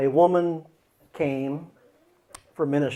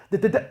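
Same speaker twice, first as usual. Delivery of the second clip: higher, louder repeated stammer; stutter; 8 semitones higher, 5 dB louder, faster than usual. Shown as a demonstration. Part checking again before saying it, stuttering. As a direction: get stuck on the opening syllable. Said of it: "A part that stutters".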